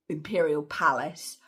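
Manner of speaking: ordinary delivery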